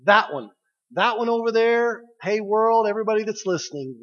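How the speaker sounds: loud, high proud announcing; a father shouting his pride to everyone; proud calling out. Acted. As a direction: point and call out to the world. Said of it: "Where he says".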